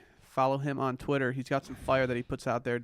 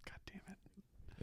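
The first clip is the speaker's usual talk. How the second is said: slow whispered lyric, no voice; 5 semitones higher, 18 dB quieter, much slower than usual. Pretend. Host whispers a [name] lyric to co-hosts, slow and unvoiced. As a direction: breathe the line out slowly, barely voiced.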